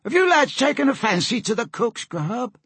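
high, loaded questioning; put-on sailor voice, pitched well up; suspicious sailor. A narrator's reading. Hungry Bob questions the boys about the empty pot, suspicious high sailor voice.

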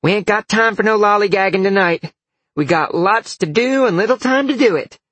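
high, loud drill call; yelled command. A narrator's reading. Dalen summons women from their rooms, yelling. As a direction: muster everyone with a shout. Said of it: "Dalen yelled".

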